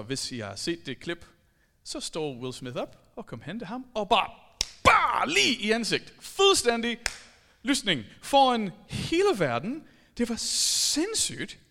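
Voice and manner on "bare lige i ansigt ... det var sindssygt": louder, worked-up telling; excited retelling. Real, not acted. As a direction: tell the slap with energy. Retelling Will Smith slap.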